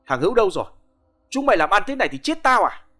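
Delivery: high, loud urgent demand; urgent and angry. Acted. Nam shouting urgently on the phone at Hữu's wife. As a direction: snap at her down the phone. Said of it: "tiếng thằng Nam gấp gáp".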